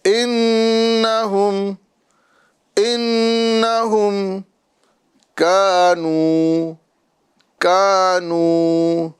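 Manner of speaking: slow, melodic tajweed chant; Quranic recitation. Acted. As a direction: recite it measured and drawn out. Recites verse.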